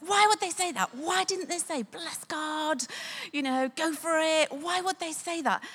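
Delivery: high-pitched indignant rant, re-enacted; really mad. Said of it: "I was really mad".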